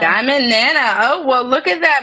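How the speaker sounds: high, loud cheerful winner reveal; excited announcement. Real, not acted. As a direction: announce the winner with real delight.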